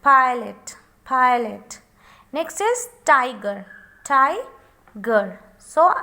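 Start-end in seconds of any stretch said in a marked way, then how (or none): 0.0-1.8 "Pilot" as a distinct "pai-let"; syllable by syllable. Shown as a demonstration. Teacher showing it has two syllables, "pilot" as "pai-let". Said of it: "having two syllable". 4.0-5.2 "tiger" broken as "tai... ger"; split into syllables. Shown as a demonstration. Teacher letting learners check the syllables, "tiger" split as "tai... ger". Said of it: "आपने check कर लिया".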